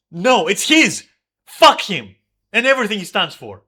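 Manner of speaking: furious, loud outburst at him; real rage yelled at the mic; angry shout. Real, not acted. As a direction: let it explode, full volume. Ranting at absent roommate.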